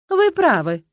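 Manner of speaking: high, syrupy agreement; honeyed. A narrator's reading. Youth turns to the castle spirit, honey-voiced. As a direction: lay on the honey. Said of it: "мёдоточивым голосом произнёс он".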